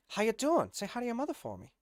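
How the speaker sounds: mock celebrity small talk; Mark Wahlberg. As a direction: imitate the star signing off, high and quick. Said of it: "Mark Wahlberg is like".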